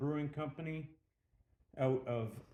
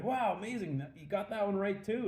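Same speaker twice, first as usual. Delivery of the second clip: much higher, wowed crowd imitation; amazed; 7 semitones higher than usual. Bar patrons react to hunter's correct guess, amazed. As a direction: act out impressed onlookers marvelling. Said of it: "they're all amazed".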